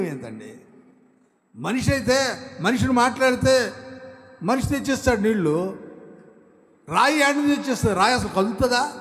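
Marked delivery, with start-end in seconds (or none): 1.6-8.9 higher and louder, quickening questions; full-force preaching. Acted. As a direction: press the rhetorical questions. Rhetorical preaching run. (వేగంగా ప్రశ్నిస్తూ బోధన)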